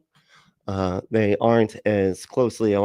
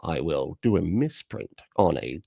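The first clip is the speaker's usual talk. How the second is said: lower, slow scheming villain voice; cartoon villain; 4 semitones lower, 4 dB quieter, slower than usual. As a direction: plot like a bond villain in a spinning chair. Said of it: "picturing some guy in a spinny chair going like this".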